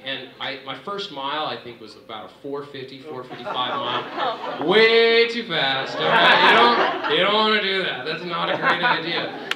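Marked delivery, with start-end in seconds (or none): 4.7-9.5 high, loud and laughing; laughing warning. Real, not acted. Runner laughing at his own reckless first mile, loud. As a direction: laugh while warning them off. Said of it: "telling the listener, laughing".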